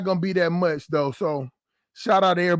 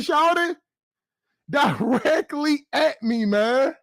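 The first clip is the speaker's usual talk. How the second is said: higher, laughing disbelief; excited; 7 semitones higher, slower than usual. Real, not acted. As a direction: tell it still rattled, laughing. Retelling gun nearly pointing at him.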